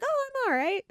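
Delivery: imitating her younger self, pitched far up and breezy; sunny honest answer. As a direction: answer cheerfully like you mean it. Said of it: "I'd be like".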